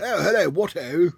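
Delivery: high, loud mock-posh English; exaggerated posh English hello. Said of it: "how the rest of the world think we talk".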